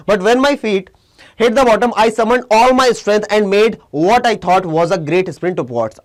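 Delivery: slow, deliberate reading of English text; slow story reading. Shown as a demonstration. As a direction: read each word out slowly for learners. Teacher reads story text aloud.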